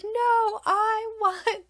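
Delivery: put-on very high, whiny-child refusal; imitating insistent toddler. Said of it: "And she's like".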